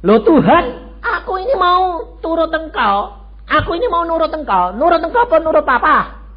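high, loud complaint to God; pleading loud prayer. Retold or imitated. Retold desperate prayer. (doa memohon dengan keras)

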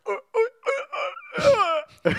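put-on slow, high strained old man groans; old man grunts. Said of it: "are you an old man now".

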